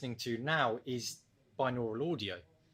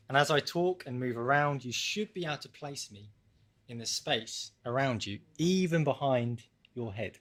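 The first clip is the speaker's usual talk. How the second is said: ordinary delivery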